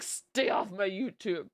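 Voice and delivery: slow, through-the-teeth warning. Retold or imitated. Aunt warning her niece off her channel, slow through the teeth. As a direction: grit it out like a stern auntie. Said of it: "you know that voice where you're talking out of your teeth".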